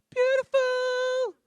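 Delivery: sung high and drawn out, swooning over halloumi; ecstatic. Pretend. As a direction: belt it like a food rapture, high and slow. Rapturous praise of halloumi.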